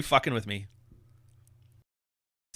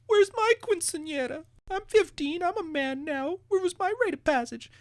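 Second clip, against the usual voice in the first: much higher whining voice; whiny man-child. Retold or imitated. Mocking grown men demanding a quinceañera. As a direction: whine like a spoiled teen boy.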